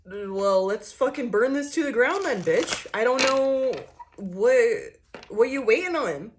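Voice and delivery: loud mock comeback; mock defiant outburst. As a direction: call their bluff, loud and daring. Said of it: "You're like".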